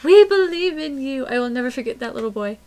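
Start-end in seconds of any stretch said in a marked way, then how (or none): 0.0-1.3 high, loud encouraging call; imitated cheering. Imitating little boy on third base cheering the pitcher, loud. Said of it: "cheering on the pitcher".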